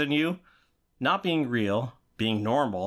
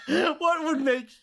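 laughing; slower than usual. Real, not acted. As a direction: laugh through the question. Bursting into laughter mid-question.

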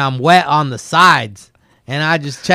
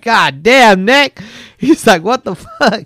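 much higher, loud appalled outburst; disgusted shock; 7 semitones higher, 5 dB louder, slower than usual. Retold or imitated. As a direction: recoil in disgust, voice shooting up. Stone sees filled toilet.

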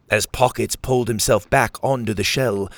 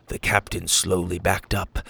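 ordinary delivery

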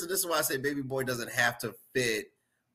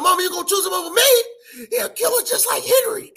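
high sobbing shout, voice cracking; crying plea; 7 dB louder, much faster than usual. Retold or imitated. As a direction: wail it like a begging child. Crying son pleads with mother.

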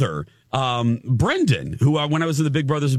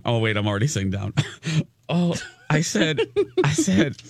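usual speaking voice

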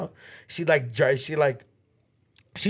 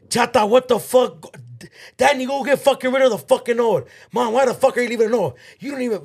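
high, loud mock scolding of mom; retold yelling; 8 semitones higher, 4 dB louder than usual. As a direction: imitate sisters ripping into their mom.

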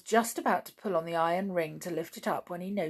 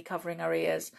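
ordinary delivery

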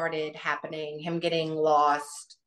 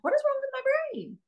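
much higher, put-on puzzled squeak; mock bewildered self. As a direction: play up her own confusion, pitched way up. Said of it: "I'm like".

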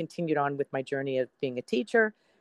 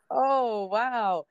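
taken-aback wow; 7 semitones higher than usual. Retold or imitated. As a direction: react as if the smugness caught you off guard. Reacting to the anchor's smug reply.

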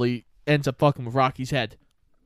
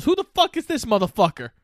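higher, mock-outraged champ; indignant Apollo; 7 semitones higher than usual. Retelling the press conference, a podcaster imitates outraged Apollo with higher, mock-indignant disbelief. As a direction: bark it out in disbelief. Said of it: "And he goes".

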